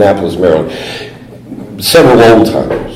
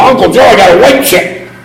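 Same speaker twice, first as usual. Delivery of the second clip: much higher, pushy and insistent; insistent, in his face; 10 semitones higher than usual. Retold or imitated. Nephew shoving his chip in his uncle's face, insistently. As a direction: demand to be noticed. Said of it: "I got right up in his face".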